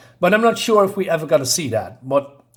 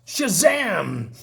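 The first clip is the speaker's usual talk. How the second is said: raised-pitch cry without added volume; mock shout; 6 semitones higher than usual. Retelling the shouted magic word, a contained mock shout. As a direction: call out a magic word, drawn out but contained. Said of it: "And he shouts".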